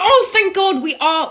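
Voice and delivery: high, drawn-out acted relief; hammed-up delighted relief; relieved gushing. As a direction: gush as if you've found an ally. Retold relief at finding an ally.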